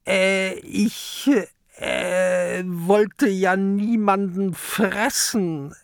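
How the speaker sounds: high, slow hemming creature voice; a big beast caught out, stammering excuses; embarrassed kraken explaining; sheepish sea monster. A narrator's reading.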